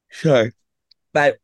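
words pulled out by a yawn. Real, not acted. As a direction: yawn through the apology. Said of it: "Stop yawning".

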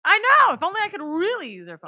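loud, high exclamation; excited outburst. Real, not acted. Joking with her cohost, a loud excited exclaim. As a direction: burst out with glee.

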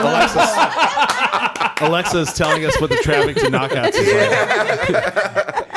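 high, wheezing laugh through the words. Real, not acted. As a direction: crack up while trying to speak. Laughing at the smart speaker.

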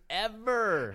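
high, drawn-out mock outrage; emphatic vow. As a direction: stretch it out high like a dramatic vow. Retold vow, emphatic outburst.

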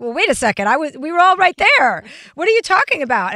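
mildly acted-out indignant objection; indignant retort. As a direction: push back in disbelief, voice rising. Acted retort to an angry post.

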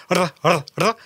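short trilled R bursts; three quick attempts at rolling the R; rolled R. Shown as a demonstration. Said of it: "If you can roll your R".

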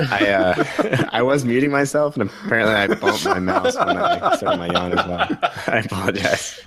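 giggling through the words; laughing. Real, not acted. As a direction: laugh while apologizing. Laughing at his own yawn mishap.

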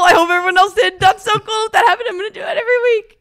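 high, loud, racing excitement; excited gleeful burst. Real, not acted. Co-host thrilled her secret went unheard, excited outburst. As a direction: burst out loudly, high and speeding up. Gleeful that the whisper trick worked.

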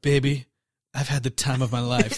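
low, soft mock-romantic line; mock romantic Johnny. Mocking Johnny's big romantic line, low and soft. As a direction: croon it low and hushed like a corny lead. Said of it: "he's like".

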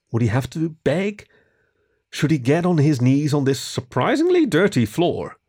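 usual speaking voice

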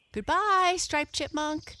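high, loud farewell call; shouted goodbye. A narrator's reading. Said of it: "they shouted".

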